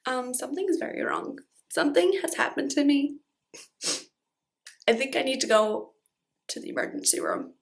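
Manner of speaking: hushed, shaky-sounding plea; quiet worried voice. Retold or imitated. To her girlfriend at the bathroom door, quiet and worried.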